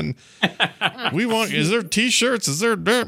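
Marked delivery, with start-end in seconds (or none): 1.1-3.1 excited fan voice. Retold or imitated. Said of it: "people were like".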